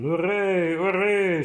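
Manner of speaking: screaming soldiers. A narrator's reading. Russian soldiers storming the trenches, loud war cry. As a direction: bellow it as an attacking mass. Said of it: "schrien sie".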